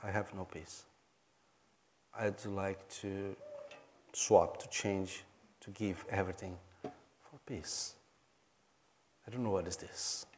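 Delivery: retold confession, low and soft, halting; quiet confession. Rich host confiding privately after dinner, quiet confession.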